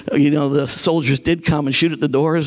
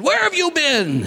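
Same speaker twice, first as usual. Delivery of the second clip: mock mad; 10 semitones higher than usual. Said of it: "And he was so mad".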